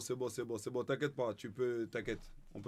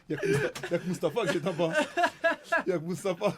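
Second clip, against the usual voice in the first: laughing; 4 semitones higher, 6 dB louder than usual. Real, not acted. Laughing answer.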